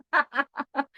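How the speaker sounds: light burst of laughter; real amused chuckle. Real, not acted. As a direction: laugh along with the audience. Amused with her audience.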